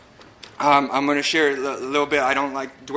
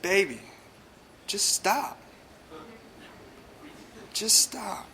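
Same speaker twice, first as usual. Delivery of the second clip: soft, higher mock-mother pleading; loving mother; 4 semitones higher, 7 dB quieter, slower than usual. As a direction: gently voice a mom's loving plea, with long pauses. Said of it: "with all the love in her heart".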